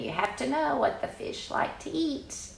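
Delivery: ordinary delivery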